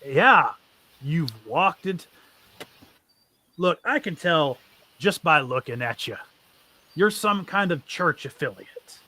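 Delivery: a bandit boss bargaining with the party; bandit leader, wary. Acted. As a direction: warn them off without a fight.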